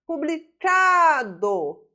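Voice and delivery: "Publicado" with a long, loud, high CA; stressed syllable demonstration. Shown as a demonstration. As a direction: hold and punch the CA in "Publicado". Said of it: "sílaba tônica".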